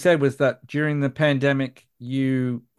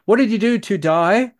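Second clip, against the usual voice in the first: higher, exaggerated Australian "today" as "to die"; broad Australian accent; 7 semitones higher, 4 dB louder than usual. Shown as a demonstration. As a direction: ham up a broad Aussie accent. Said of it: "makes it sound like we're saying".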